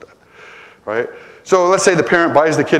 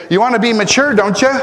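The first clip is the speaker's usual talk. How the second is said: rhetorical question; 10 semitones higher, 6 dB louder than usual. Pretend. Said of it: "I could just say".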